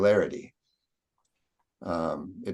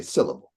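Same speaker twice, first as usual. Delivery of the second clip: "syllable" said normally with SIL stressed; 5 semitones lower than usual. Shown as a demonstration. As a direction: land on the first beat of "syllable". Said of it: "The sil there is what I'm stressing".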